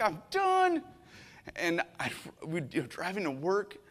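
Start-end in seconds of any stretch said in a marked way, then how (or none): 0.0-0.8 much higher, exasperated cry of I'm done; angry I'm done. Retold or imitated. Pastor reliving his anger after losing Calvin, exasperated. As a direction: throw up your hands, you're done. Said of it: "I was so angry".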